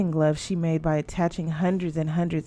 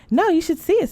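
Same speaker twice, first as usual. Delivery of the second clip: much higher, loud eager protest; excited insisting; 11 semitones higher, 3 dB louder, faster than usual. A narrator's reading. Sister on phone insisting she see the doll, excited.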